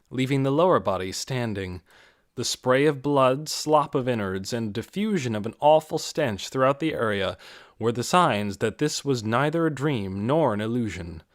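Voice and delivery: ordinary delivery, nothing marked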